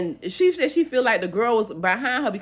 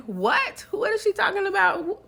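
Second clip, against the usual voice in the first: high-pitched, baffled questioning; mock bewildered outburst; retold disbelief; 11 semitones higher than usual. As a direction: act out an incredulous "what?". Retold bewildered reaction at funeral.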